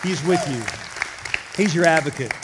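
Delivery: loud, high, driving preacher voice; fervent preaching. Acted. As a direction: preach it out to the whole church. Preaching at full force.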